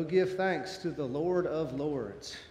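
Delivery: ordinary delivery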